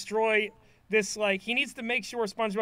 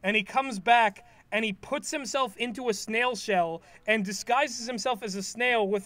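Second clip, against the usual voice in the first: ordinary talk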